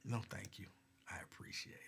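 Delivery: a dry whispered thanks. Real, not acted. Whispering a polite no to a streamer's offer. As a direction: whisper the thanks as a wry aside. Declining an offer of cheap viewers.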